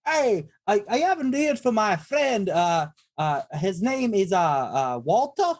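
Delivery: put-on high, hammy Italian accent; thick Italian accent. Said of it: "I'll use a thick Italian accent".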